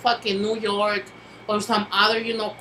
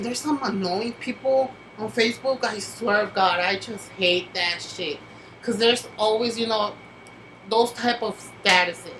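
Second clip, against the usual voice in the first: usual speaking voice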